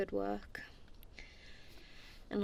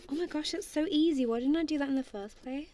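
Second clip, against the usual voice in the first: delighted; 6 semitones higher than usual. Real, not acted.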